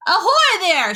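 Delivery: loud high hail; shout. A narrator's reading. Police chief hails travelers atop the peach, shouting. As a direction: hail them from far below, high and loud. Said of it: "shouted the chief of police".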